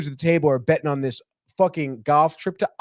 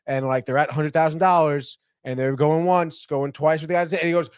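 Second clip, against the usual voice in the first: ordinary delivery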